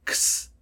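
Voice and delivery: slow, whispered ks; ks sound. Shown as a demonstration. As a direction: say x as k plus a hiss. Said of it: "como una K y una S".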